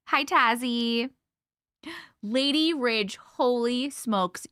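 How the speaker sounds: ordinary delivery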